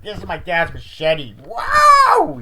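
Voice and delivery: high, loud shout breaking upward on wow; hammed-up show-off yelling; shouting out about a machete, voice cracking high; mock excited shout. Friend shows off a machete.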